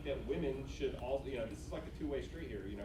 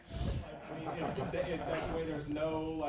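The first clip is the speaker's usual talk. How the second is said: laughing through the words, louder; 3 semitones higher, 12 dB louder than usual. Real, not acted. A student laughing after being teased about a blunt rejection, laughing. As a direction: talk while breaking into real laughter. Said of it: "You like a good fuck off".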